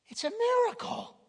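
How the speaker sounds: high, bright burst of wonder; excited exclamation. Real, not acted. Preacher to congregation, marvelling at changed lives, exclaiming. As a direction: cry out at the miracle.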